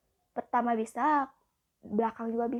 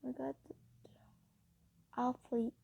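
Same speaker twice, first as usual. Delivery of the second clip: ordinary delivery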